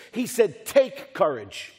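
"Take" stretched and punched, set apart from "courage"; slow right down and lean on "Take"; stress on Take. Shown as a demonstration. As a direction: hit "Take" hard, then let "courage" fall. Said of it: "he doesn't say, "Be courageous".

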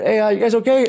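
loud, high call across the street. Retold or imitated. Man calling to a tipsy couple, loud call. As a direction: call out to strangers, checking on them.

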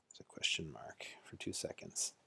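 whispered aside to self. Real, not acted. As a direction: drop to a breathy whisper. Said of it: "whispered aside while typing".

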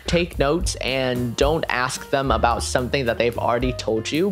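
ordinary talk, nothing marked

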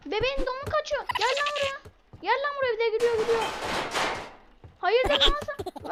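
put-on shrill up high chasing the baby; high panicked chase voice. Gamer chasing the escaping baby, calling high and frantic.

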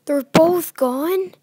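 loud, slow startled question; shocked they've vanished; real loud alarm; alarmed surprise. Real, not acted. Animatronics vanish from cameras.

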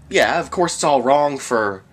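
usual speaking voice

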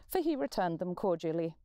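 ordinary delivery

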